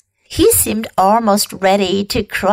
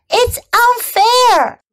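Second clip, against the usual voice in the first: tearful protest; 12 semitones higher, 4 dB louder than usual. A narrator's reading. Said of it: "she sobbed".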